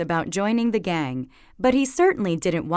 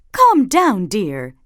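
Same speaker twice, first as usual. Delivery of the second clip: put-on calm her child down; soothing mother; 6 dB louder, slower than usual. Mama soothing her upset daughter. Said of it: "said Mama".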